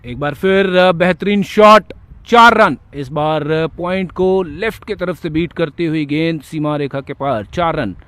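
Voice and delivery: loud boundary call, slowing on the shot; excited cricket commentary. Acted. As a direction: call the four with a big lift. Live play-by-play of a boundary.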